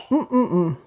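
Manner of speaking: low pleased mm-mm-mm; savoring hum. Real, not acted. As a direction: hum with delight at the taste. Tasting the toasted bread.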